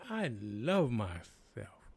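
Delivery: slow, soft, loving words to yourself; tender self-affirmation. Acted. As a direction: say it gently, like a hug in the mirror. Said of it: "hug yourself and say".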